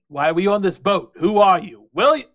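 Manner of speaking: loud demands climbing in pitch; angry goblin confronting the crew; indignant. Acted.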